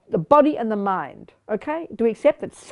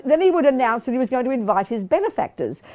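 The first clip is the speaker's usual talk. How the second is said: ordinary talk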